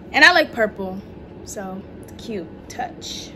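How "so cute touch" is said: whisper. Real, not acted. Quiet aside.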